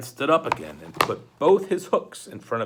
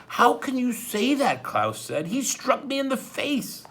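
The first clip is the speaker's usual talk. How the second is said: put-on higher, indignant young boy; 12 semitones higher, 3 dB louder than usual. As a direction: protest with hurt disbelief. Klaus protests, hurt.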